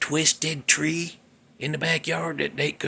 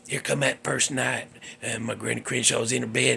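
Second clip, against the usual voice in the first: ordinary delivery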